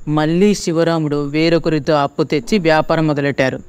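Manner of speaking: ordinary delivery, nothing marked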